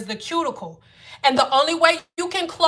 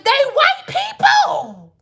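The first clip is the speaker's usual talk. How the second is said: exasperated squeal; 12 semitones higher, 4 dB louder, slower than usual. Real, not acted. Exasperated, viewers are watching.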